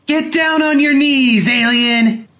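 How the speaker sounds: put-on high, slow, loud child's command; shrill playground order to kneel; bossy child. A narrator's reading. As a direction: play a bratty boy ordering an alien. Child playing alien game.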